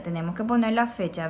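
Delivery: ordinary delivery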